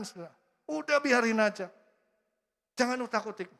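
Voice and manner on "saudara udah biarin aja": ordinary talk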